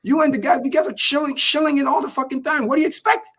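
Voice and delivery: high, incredulous rant at the guy; exasperated retort. Retold or imitated. Streamer mocking the influencer's affiliate link, incredulous. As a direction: pitch it up, disbelieving and fed up. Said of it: "I'm like".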